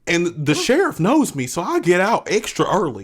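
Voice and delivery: loud, hammed-up Southern threat; Southern accent. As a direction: play a riled country character. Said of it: "I'm gonna start talking in a Southern accent".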